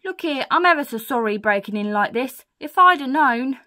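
loud, emphatic apology; Burglar Betty. Acted. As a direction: apologise profusely as a lady burglar. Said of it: "Then she says".

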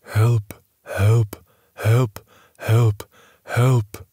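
flat, hollow, numb repeated plea; drained voice, hollow and flat. A narrator's reading. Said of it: "in a flat and hollow voice".

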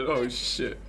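ordinary delivery

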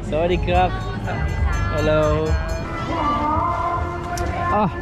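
normal talking voice

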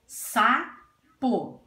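"Sapo" split as "Sa... po", each beat distinct; syllable by syllable. Shown as a demonstration. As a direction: say "Sapo" in two pieces with a gap.

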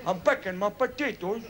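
lightly voiced Scottish farmer; wee Scottish farmer. Wee farmer answering the big American, lightly put-on voice. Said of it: "This is me that's doing the voices".

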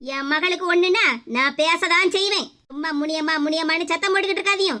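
a stern mother catching the two of them out; low, scolding mother's voice. Acted. Mother fights back at husband.

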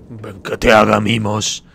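loud, slow mock-doting voice; playful coaxing. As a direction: coax a little creature with big drawn-out words. Coaxing a gnome to cuddle. (llamada mimosa, fuerte y lenta)

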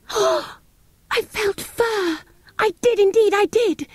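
very high, breathless excitement; thrilled at feeling fur; excited cry. Acted. Girl tells siblings she felt fur, very high excited cry. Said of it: "she cried".

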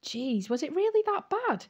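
a high, gasping disbelief; gasped. A narrator's reading. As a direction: gasp it out in shock. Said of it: "he gasped".

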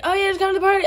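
high, mock-casual persuading voice; high-pitched mimicry of someone coaxing; coaxing guy imitation. Imitating the kid coaxing her along.

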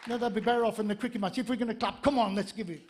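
ordinary delivery

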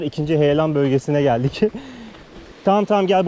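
normal talking voice

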